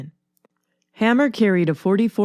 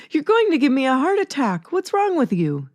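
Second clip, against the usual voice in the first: high-pitched startled outburst; startled exclaim; 7 semitones higher than usual. A narrator's reading. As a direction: blurt it out, shaken awake and alarmed. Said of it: "she exclaims".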